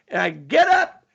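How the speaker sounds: ordinary talk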